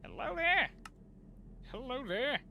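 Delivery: high lilting "hello there" to the machine; sing-song call. Real, not acted. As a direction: coax the silent device. Calling to unresponsive machine.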